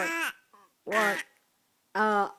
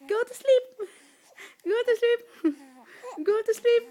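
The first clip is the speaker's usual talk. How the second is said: high-pitched, playful parental insistence; 7 semitones higher, 4 dB louder than usual. Real, not acted. Parent telling a fussy baby to sleep, high-pitched urging.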